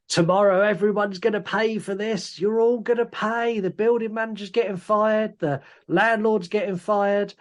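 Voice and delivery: high, insistent mock outrage; retold indignant threat. Retelling the locked-in man threatening everyone, mildly indignant.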